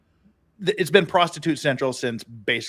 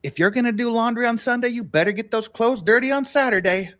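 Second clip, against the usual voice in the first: bartender's advice; 8 semitones higher than usual. Retold or imitated. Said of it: "He said".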